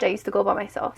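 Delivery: ordinary talk, nothing marked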